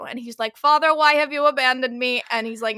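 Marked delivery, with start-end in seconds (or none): 0.6-2.2 hammed-up plea, higher and louder; wailing monster. Creature asks Victor why he abandoned it, hammed-up wail. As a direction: play a forsaken creature crying out to its maker. Monster pleading with its maker, acted.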